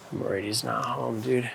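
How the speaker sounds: quiet aside to a companion; hushed worry. Real, not acted. Photographer to interviewer at the door, hushed worry. Waiting at friend's door, speaking low.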